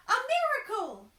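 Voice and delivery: very high, loud amazed cry; astonished outburst. Acted. Merchant's wife seeing the young man, high loud astonished cry. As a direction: shriek in astonishment. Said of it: "she burst out".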